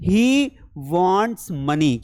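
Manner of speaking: he wants money said very slowly, word by word; slow dictation. Shown as a demonstration. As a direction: spell it out slowly for the class. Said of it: "क्या बोलेंगे?". (धीरे-धीरे बोलकर सिखाना)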